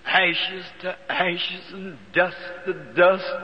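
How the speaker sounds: slow, solemn graveside intoning; slow, solemn funeral committal, lightly voiced; solemn funeral words. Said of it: "the Methodist preacher".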